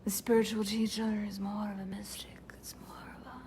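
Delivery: mystic whisper. Shown as a demonstration. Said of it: "there's an energetic difference".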